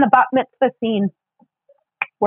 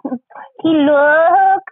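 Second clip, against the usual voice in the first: high, stretched mock foreign accent; comic broken-English accent, pitched up; Miss Swan; 6 semitones higher than usual. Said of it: "That's a pretty good Miss Swan".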